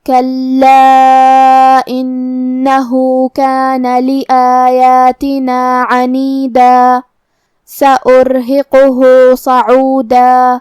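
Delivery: very slow, drawn-out melodic tajweed; Quran recitation. Shown as a demonstration. Teacher reciting the verse for students, slow melodic tajweed. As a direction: chant each word long and measured.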